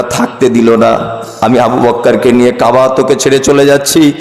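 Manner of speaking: low, sorrowful, lingering lament; mock-tearful farewell. Prophet bidding farewell to the Kaaba, acted sorrow. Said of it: "অঝর নয়নে কাঁদছেন আর বলছেন".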